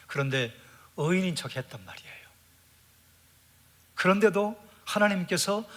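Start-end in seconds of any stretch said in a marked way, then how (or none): 1.0-2.2 whispered aside to the congregation. Real, not acted. Preacher whispering Job's secret pretense to the congregation. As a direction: lean in and whisper it like a confession.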